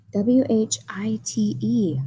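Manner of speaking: letters whispered one by one; whispered spelling. Real, not acted. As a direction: breathe each letter like a secret. Spelling aloud to children.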